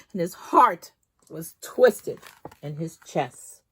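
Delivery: plain reading voice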